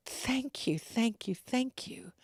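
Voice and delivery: soft, hushed grateful thanks; quietly repeated thanks, lightly acted; retold tearful thanks. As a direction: gently imitate someone overcome with gratitude. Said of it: "the woman burst into tears".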